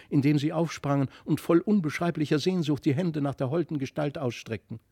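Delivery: ordinary delivery, nothing marked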